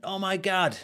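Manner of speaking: high, loud American exclamation; awful American accent. Retold or imitated. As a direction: blurt it in a hammed-up American accent. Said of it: "That's a really awful American accent".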